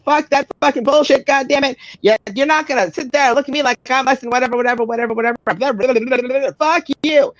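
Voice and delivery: rip into them, spitting every curse; angry rant. Retold or imitated. Woman's angry tirade retold.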